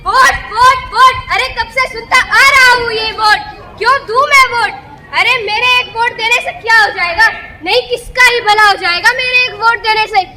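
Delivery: loud, exasperated rant; angry outburst. Acted. Fed-up voter ranting about endless demands to vote, loud anger. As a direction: rail at being asked to vote. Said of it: "क्यों इतना गुस्सा कर रहे हो".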